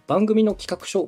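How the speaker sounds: normal talking voice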